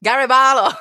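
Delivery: loud, high-pitched northern impression. Chef showing off to the interviewer, loud northern impression. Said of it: "doing the [name] voice".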